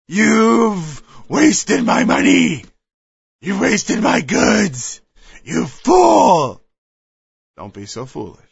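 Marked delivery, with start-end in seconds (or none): 0.1-6.6 slow, loud furious accusation; angry rant. Acted. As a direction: hurl the blame at him, slowly. Acted angry rant at someone.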